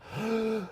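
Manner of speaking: sharp high gasp of feigned shock; mock outraged gasp. Podcaster mocking media crybabies, mock gasp. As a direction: gasp as if mortally offended. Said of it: "then they cry wolf".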